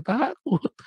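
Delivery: admitting fear to the congregation, high mock squeak; mock terrified. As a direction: answer your own question in a scared squeak. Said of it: "Takut nggak?".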